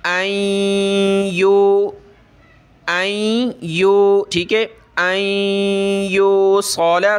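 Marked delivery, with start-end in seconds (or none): 5.0-7.2 अय्यूसल with a thick, heavy स; heavy saad. Shown as a demonstration. Teacher showing students a heavy saad. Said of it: "स्वाद को मोटा करें".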